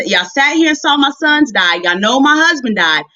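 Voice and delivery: ordinary talk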